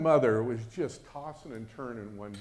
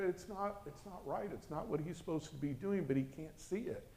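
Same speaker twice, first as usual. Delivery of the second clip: soft, higher-pitched mother voice; hushed fretful retelling; 6 semitones higher, 8 dB quieter than usual. Retold or imitated. Mother retells her dream to her child, hushed and fretful. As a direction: quietly plead a worried case. Mother's anxious account, retold.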